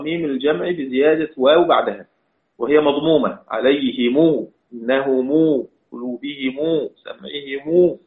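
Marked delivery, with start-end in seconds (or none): 3.5-8.0 recited examples. Shown as a demonstration. Lists recited Quran word examples.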